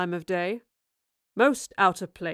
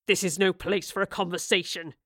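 mild snarl; 2 semitones lower than usual. Acted. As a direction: hold back the anger, just a hint of menace. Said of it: "he snarled".

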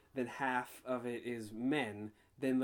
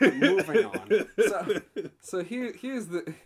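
slow, laughing, louder "moving on"; drawn-out laughing; 6 semitones higher, 9 dB louder, slower than usual. Real, not acted. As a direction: laugh through it, drag it out. Laughing, repeats to push topic on.

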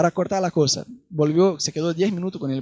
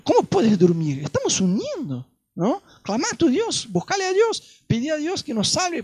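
urgent sailor; 7 semitones higher than usual. Retold or imitated. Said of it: "habló con él".

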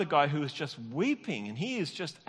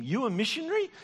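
amazed question; 7 semitones higher, 3 dB louder than usual. Retold or imitated. Pastor amazed at confession.

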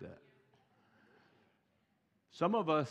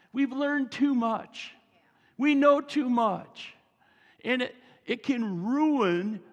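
higher and louder, slowed on key phrases; impassioned preaching; 9 semitones higher, 6 dB louder than usual. Acted. Pastor driving home the point to his church, fervent. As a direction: press the point hard to the congregation.

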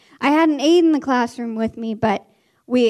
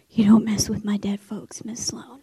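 hushed warning; 2 semitones lower than usual. Retold or imitated. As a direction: imitate a calmed-down kid warning the teacher. Calmed pupil warns her teacher.

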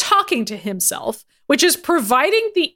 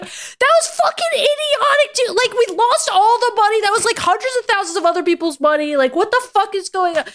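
mock screaming; 8 semitones higher than usual. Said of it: "They're like".

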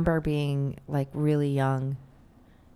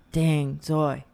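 brother impression. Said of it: "you have your brother".

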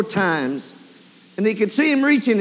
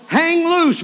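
high, raised holler; 8 semitones higher, 5 dB louder than usual. Acted. Man on the roof to the man being lowered, hollering. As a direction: call it out to someone below. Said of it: "looked down and hollered".

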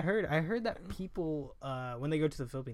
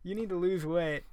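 excited retelling; 4 semitones higher, 11 dB louder than usual. Retold or imitated. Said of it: "He was like".

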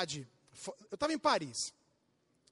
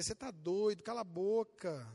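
1 muttered retort; 6 semitones lower, 6 dB quieter than usual. Retold or imitated. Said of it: "A vontade que dá é dizer".

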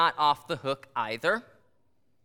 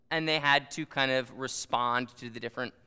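usual speaking voice

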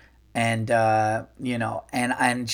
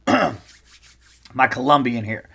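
while sipping a drink. Real, not acted. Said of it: "Sorry, I'm just sipping my".

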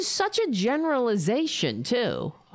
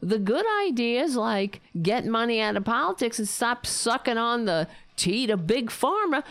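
ordinary talk

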